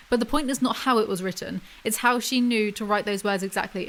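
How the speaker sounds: ordinary talk, nothing marked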